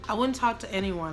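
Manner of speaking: ordinary talk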